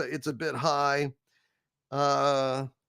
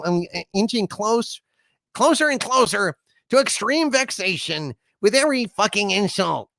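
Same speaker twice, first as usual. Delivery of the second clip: inching toward a rage, pitched up; a touch of mounting vexation; 6 semitones higher, 3 dB louder than usual. Acted. [name] warning viewers he is nearly fed up, high and irked.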